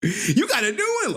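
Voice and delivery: high, laughing mock question; mock curious questioner. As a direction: imitate an eager viewer, high-voiced. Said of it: "You like".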